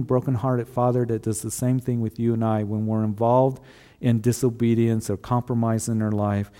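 ordinary delivery

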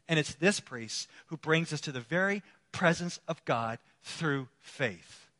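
normal talking voice

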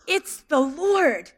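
slow, awed; slow awestruck realization; slow revelation. Acted. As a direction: let it land slowly in wonder.

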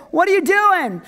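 high whiny complaint; mock tantrum. Joshua throwing a fit at the Lord, mock tantrum. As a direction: whine at God in exasperation. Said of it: "he's throwing a fit".

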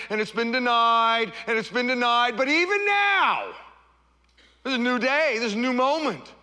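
high, loud, rising sermon cadence; building preaching. Acted. Pastor rousing the congregation, building fiery preaching. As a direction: preach it with mounting fervour.

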